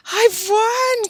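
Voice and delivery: high, loud triumphant cry; crowing. Acted. Billy crowing about winning the bet. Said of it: "crowed Billy".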